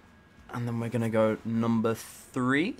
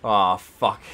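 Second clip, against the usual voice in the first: ordinary delivery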